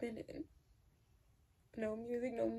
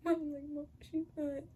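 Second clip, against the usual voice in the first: tearful; 3 semitones higher than usual. Real, not acted. Telling boyfriend about mom, tearful and breaking. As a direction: voice breaking into tears. Retelling mother's collapse, tearful.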